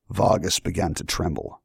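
ordinary delivery

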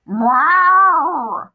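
long, loud, high cat meow, falling at the end; drawn-out, forceful cat call; insistent meow. A narrator's reading. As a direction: yowl like a demanding cat. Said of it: "Muffin said more insistently".

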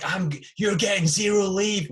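loud, high, slow furious yell; furious shout. Retold or imitated. Sergeant major screaming at the late private, furious shout. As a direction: roar it like an enraged sergeant major. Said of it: "he just flips the fucking lid".

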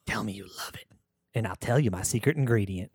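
creepy breathy whisper. Acted. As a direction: whisper it with a sly edge. Unvoiced whisper, coaxing.

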